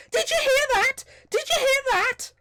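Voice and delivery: tiny excited voice. A narrator's reading. Tiny voice in the troll's head: squealing excitement. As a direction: squeal it like a thrilled tiny creature. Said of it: "very excited voice".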